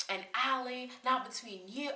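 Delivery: high mock telling-off; retold screaming argument. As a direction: re-enact putting a roommate in her place. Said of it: "So I'm screaming at Megan".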